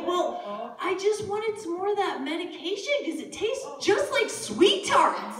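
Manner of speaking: put-on high-pitched child's excuse; explaining kid. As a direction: justify yourself to mom as the little son. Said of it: "He's like".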